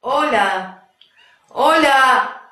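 loud, high desperate shouts down the line; shouting into the phone. Acted. Woman on a cut phone call, shouting desperately. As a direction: yell hello into a dead line, strained.

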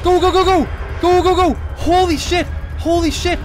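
high, loud panicked yelling; real panic. Real, not acted. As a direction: yell for your life while fleeing. Jump scare, fleeing in horror game.

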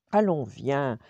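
lightly acted urging to come along; insistent. A narrator's reading. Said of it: "insiste Clochard". (légèrement insistant)

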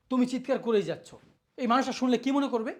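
ordinary talk, nothing marked

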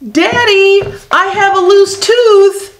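put-on high, carrying child's call across the beach; shouting. Sal calls to her father digging clams, high shout. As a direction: call out to Daddy from far off. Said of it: "she shouted".

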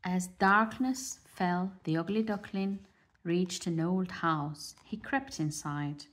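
usual speaking voice

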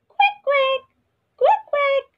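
very high quacks; duck quacking. Shown as a demonstration. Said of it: "How does duck make the sound?".

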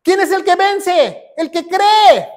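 high-pitched preacher's call and answer; emphatic preaching. Real, not acted. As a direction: ask it high, then shout the answer. Predicador pregunta y responde con fuerza. (pregunta y respuesta de predicador, en tono alto)

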